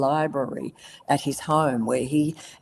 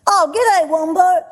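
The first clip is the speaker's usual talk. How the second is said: high, loud cheery koala hello; Blinky greeting; 14 semitones higher, 10 dB louder than usual. Retold or imitated. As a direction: greet him brightly as Blinky. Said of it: "and Blinky would say".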